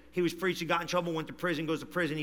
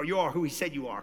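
ordinary delivery

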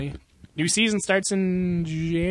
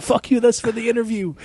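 cursing while laughing; can barely get it out for laughing; laughing through quote; 6 semitones higher than usual. Retold or imitated. The North Korean character taunting, laughing through it.

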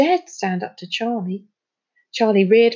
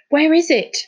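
sharp, higher urgent question; 8 semitones higher, 5 dB louder than usual. A narrator's reading. Finn urgently asks Charlie where the boat is. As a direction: press for an answer right now. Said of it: "said Finn urgently".

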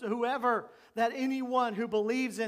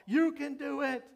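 mock crowd shout; 6 semitones higher than usual. Preacher voices the crowd urging the daredevil on, mock shout. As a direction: mimic a crowd egging someone on. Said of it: "they would all shout".

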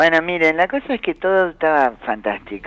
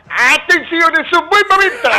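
very high mock yell; Robbie; 14 semitones higher, 3 dB louder than usual. Fooling around at the dinner as Robbie, high shouted imitation. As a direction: holler it like a catchphrase, pitched way up. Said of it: "gritaba, como decía Robbie".

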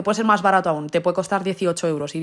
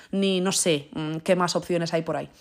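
ordinary talk

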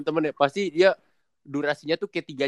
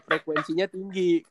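ordinary delivery

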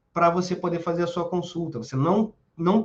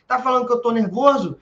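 higher and louder, challenging; mock angry outburst; 6 semitones higher, 5 dB louder than usual. Aggressor escalating at his partner, mock angry. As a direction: snap it back as if provoked. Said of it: "E já era".

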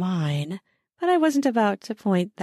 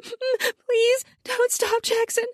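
very high, near-crying begging; whimpering plea; slower than usual. Acted. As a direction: beg between big whimpers. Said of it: "between big whimpers".

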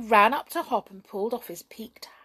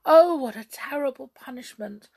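loud, raised lament; weeping; 5 semitones higher, 8 dB louder than usual. A narrator's reading. Jump lamenting the punishment, mildly weeping. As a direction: bewail it with a touch of tears. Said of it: "wept Jump".

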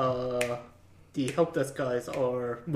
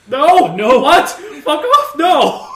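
loud high laughing yell; laughing refusal; 12 semitones higher, 15 dB louder, slower than usual. Retold or imitated. Coworker refusing the Windows ten guy title, loud laughing yell. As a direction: shout it off, half laughing, rejecting the label.

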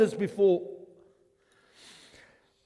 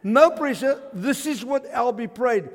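excited shout; 4 semitones higher, 6 dB louder than usual. Retold or imitated. Announcing the prayer to cousin.

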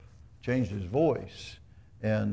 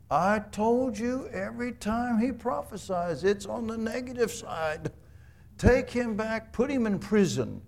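higher, exasperated royal order; angry king; 8 semitones higher than usual. Retold or imitated. King Ahab ordering his guards to jail Micaiah, exasperated higher voice. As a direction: snap out the order like an offended king.

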